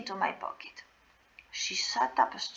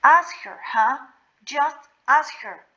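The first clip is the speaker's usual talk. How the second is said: higher and louder, pleading in panic; scared, near tears; 4 semitones higher, 6 dB louder than usual. Retold or imitated. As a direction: beg them to ask her, close to crying. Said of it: "I got so scared I was about to cry".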